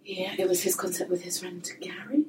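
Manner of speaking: hushed gossipy whisper. Real, not acted. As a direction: whisper it like a secret. Gossipy aside about Gary.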